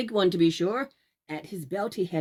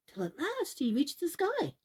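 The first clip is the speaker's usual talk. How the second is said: rising wonder; 8 semitones higher, 3 dB quieter than usual. A narrator's reading. Climax of the climb.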